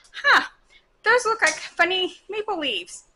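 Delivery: high-pitched mock curiosity; imitated puzzlement. Imitates husband puzzling over the leaves.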